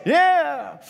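loud, high-pitched cheer; big drawn-out "hey, yeah"; sample cheer. Shown as a demonstration. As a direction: whoop it up like an approving crowd. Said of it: "you can say something like".

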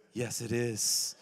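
slow calm insistence. Retold or imitated. God's slow reply.

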